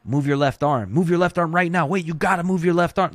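panicked coach barking over and over; frantic coach. Retold or imitated. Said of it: "That just sounds frantic and weird".

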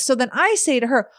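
usual speaking voice

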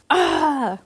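sudden high yelp; excited cry. A narrator's reading. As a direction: let out a quick excited cry. Dramatic dive exclamation.

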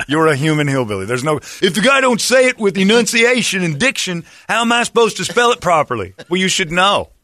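high, hammed-up hillbilly tirade. Hammed-up hillbilly spelling quarrel.

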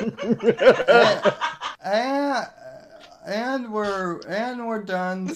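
laughing. Real, not acted. Laughing while signing off.

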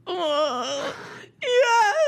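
high, sobbing yes; crying admission. Retold or imitated. Son admits the charges to parents, sobbing. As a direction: imitate a kid breaking down as he admits it. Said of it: "burst into tears".

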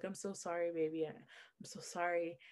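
soft, hushed imitation of a sorry boyfriend; tender retold apology. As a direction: murmur an apology, as if leaning in close. Ex kisses her and apologizes.

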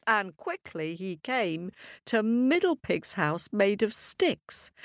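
usual speaking voice

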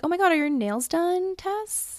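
high-pitched gushing question, rising at the end; excited slip-up. Pretend. Friend imagining tipping off the bride-to-be, high and excited. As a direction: pretend to blurt it out, voice climbing high.

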